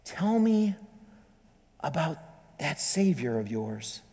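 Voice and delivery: guilty interrogator pleading softly at night; secret plea. Retold or imitated. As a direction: murmur it so no guard hears. Questioner sneaks to her cell.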